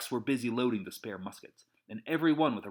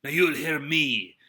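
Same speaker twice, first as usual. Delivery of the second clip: plain reading voice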